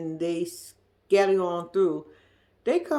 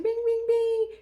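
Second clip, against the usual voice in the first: mock dog voice; slower than usual. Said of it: "The dog said".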